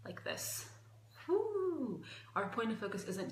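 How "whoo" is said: playful whoo. Shown as a demonstration. Showing scattered focus.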